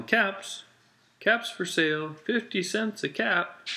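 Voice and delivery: high, sing-out vendor cry; street seller's call. A narrator's reading. Peddler calling caps for sale in town, street seller's cry.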